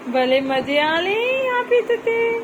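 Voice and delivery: high, slow coo. Real, not acted. Mother teasing baby about having fun, high baby talk.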